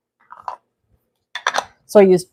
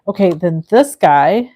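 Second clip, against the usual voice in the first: usual speaking voice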